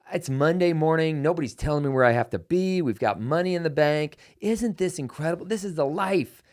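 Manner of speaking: a retold rush of delight; retold excited boast. Retelling his own excited boast.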